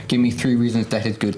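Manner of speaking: usual speaking voice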